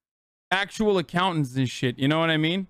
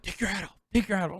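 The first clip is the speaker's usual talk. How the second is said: half-whispered mock order, much softer; hushed urging; 5 dB quieter, faster than usual. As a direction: imitate a friend urgently prompting under his breath. Retelling friend's hushed order.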